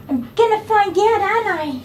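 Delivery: very high, singsong cooing. Real, not acted. Groomer to dog before trimming his nails, high baby talk. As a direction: squeal it playfully at a puppy.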